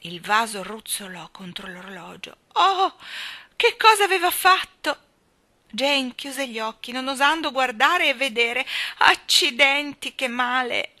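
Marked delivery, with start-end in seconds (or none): 2.6-5.0 high, loud shocked exclamation; alarmed, raised voice; horrified dismay. A narrator's reading. Horrified after breaking the vase. 9.0-11.0 loud, high cry of pained complaint; slow, raised scolding voice; clear, reproachful. A narrator's reading. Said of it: "Una voce chiara e piena di rimprovero".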